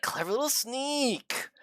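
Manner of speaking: slow, drawn-out mock Xigbar line; imitating Xigbar. Streamer mimics Xigbar's weird line, slow and drawn out. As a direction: savour each word like the odd villain. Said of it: "he says it so weirdly".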